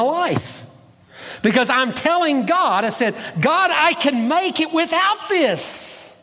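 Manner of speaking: high-pitched, fervent pulpit declaration; impassioned preaching. Real, not acted. Preacher telling God he is free to give, fervent. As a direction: lift the pitch high and proclaim it.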